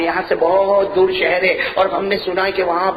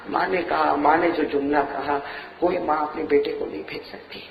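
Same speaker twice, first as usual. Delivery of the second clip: ordinary talk, nothing marked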